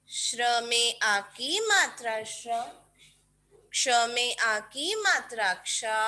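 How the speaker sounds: put-on steady syllable dictation for kids. Shown as a demonstration. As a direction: say each syllable clearly to be written down. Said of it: "बोल बोल के लिखेंगे kids".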